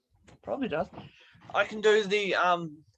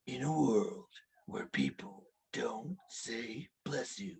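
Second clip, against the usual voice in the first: low whispered movie-trailer voice; hushed trailer-announcer whisper; 9 semitones lower, 13 dB quieter, slower than usual. Retold or imitated. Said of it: "movie trailer impression".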